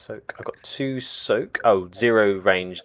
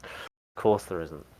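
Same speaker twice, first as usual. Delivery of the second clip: softer, slower, deflated repeat; a quiet resigned echo to himself; resigned mutter; 4 semitones lower, 7 dB quieter than usual. Real, not acted. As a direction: say it again under your breath, giving up.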